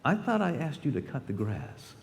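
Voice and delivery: a father's hushed rebuke. Retold or imitated. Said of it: "he would lower his voice and say".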